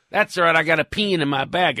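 mock Texas accent, vowels stretched. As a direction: imitate a Texan golfer. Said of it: "I talk about accent difference".

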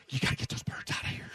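urgent retold whisper; panicked assistant whispering an order. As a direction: hiss the order with no voice. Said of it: "She goes".

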